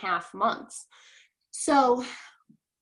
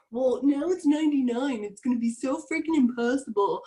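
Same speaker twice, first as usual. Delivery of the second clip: mock complaint; 2 semitones higher than usual. Mimics a discouraged distributor's complaint.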